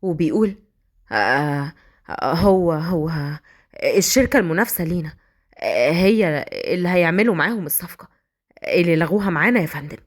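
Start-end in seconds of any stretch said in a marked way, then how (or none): 1.1-10.0 scared stammer. Acted. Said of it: "كان واقف العميل بخوف".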